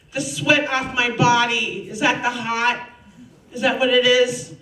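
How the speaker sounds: ordinary delivery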